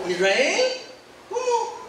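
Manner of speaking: sorpresa incrédula, subiendo agudo; incredulous surprise. Acted. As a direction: como quien no puede creer lo que oye. Josué no puede creerlo. (Josué oye que Israel pecó, incrédulo)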